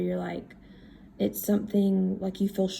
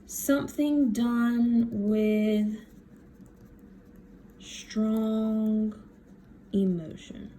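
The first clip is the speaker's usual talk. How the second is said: drawn-out words, long gaps between; slow dictation; much slower than usual. Real, not acted. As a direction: voice each word while writing it. Writing the definition while saying it.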